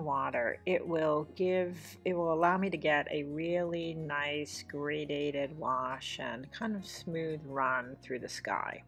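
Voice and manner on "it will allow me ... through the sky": ordinary talk, nothing marked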